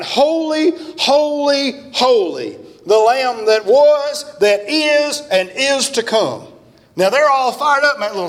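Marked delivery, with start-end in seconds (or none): 0.0-6.5 high, slow, ringing proclamation of holy; soaring preacher's cry; fervent preaching. Acted. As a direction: lift it up slowly like a revival preacher crying out. Preaching scripture at full force.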